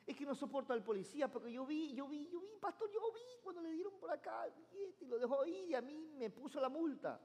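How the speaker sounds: complaining churchgoer. Retold or imitated. Churchgoer complains to the pastor about the policeman, aggrieved. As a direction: whine like you saw it yourself.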